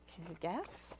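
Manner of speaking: ordinary delivery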